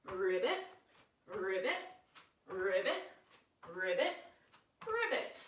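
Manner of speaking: playful repeated ribbit, rising at the end; frog ribbits. Shown as a demonstration. Yoga teacher leading children in frog croaks, playful. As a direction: croak like a little frog for the kids. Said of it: "we're gonna go ribbit".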